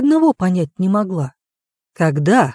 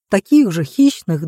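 plain reading voice

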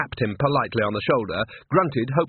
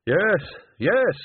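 high, eager double yes; raised, grateful agreement; 8 semitones higher, 3 dB louder than usual. A narrator's reading. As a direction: seize on it with relief. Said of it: "said Bucket gratefully".

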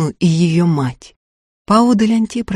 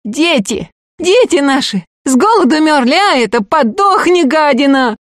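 furious cry; 7 semitones higher than usual. Acted. The mob to Ustinya, higher furious yelling. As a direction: scream at her with fury. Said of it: "яростный крик".

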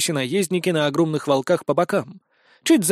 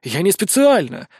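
plain reading voice

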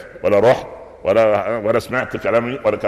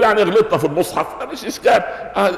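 laughing mock excuse; 9 semitones higher than usual.

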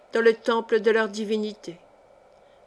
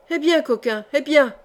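higher-pitched, brash call; brash, offhand cry, pitched up; cavalier shout; 4 semitones higher, 4 dB louder than usual. A narrator's reading. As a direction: call out cockily from the doorway. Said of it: "cria une voix cavalière".

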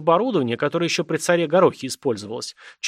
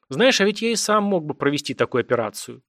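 usual speaking voice

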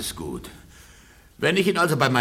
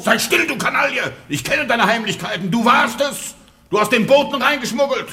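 shrill jealous husband scolding the servant; angry scolding; 12 semitones higher than usual. Acted. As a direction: tear into her with rage. Husband berates maid Claudine.

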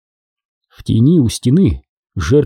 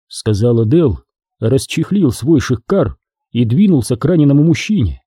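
usual speaking voice

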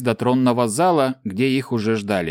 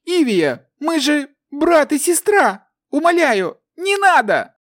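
high desperate tearful begging; sobbing plea; 3 dB louder, slower than usual. A narrator's reading. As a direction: plead for life through sobs. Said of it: "рыдающий мальчишка".

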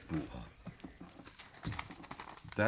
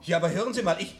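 flustered protest; 6 semitones higher, 6 dB louder, faster than usual. Acted. Protesting at gunpoint.

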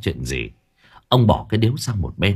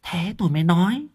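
put-on high, sly old woman murmuring in his ear; wife plotting over the tomb, high sly murmur; scheming wife whisper; 11 semitones higher than usual. A narrator's reading. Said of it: "thì thầm vào tai chồng".

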